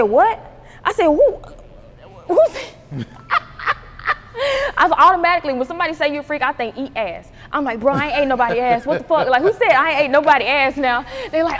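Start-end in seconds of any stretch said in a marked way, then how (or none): 0.0-2.8 high, loud incredulous questions; shocked disbelief. Retold or imitated. Acts out her shocked reaction. 2.8-4.7 high-pitched bout of laughing; laughter. Real, not acted. Laughs at her own story. 7.8-11.0 loud, higher-pitched mock outrage; indignant denial. Acts out denying the accusation.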